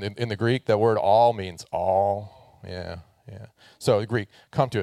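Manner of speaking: normal talking voice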